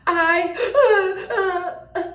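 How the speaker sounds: high, hammed-up fake sobs; whimpering pretend boo-hoo; mock crying. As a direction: imitate someone bursting into put-on tears. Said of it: "with tears".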